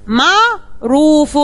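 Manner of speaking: معروف very slowly, split into its syllables. Shown as a demonstration. Teacher splitting a word for third graders, slow syllables. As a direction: break معروف into slow beats. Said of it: "هنحلل الميم والعين مع بعض".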